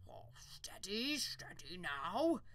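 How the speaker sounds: slow, soothing horse-tamer coax; gentle it down like a skittish horse. Acted. Farmer to the unicorn, slow soothing coax.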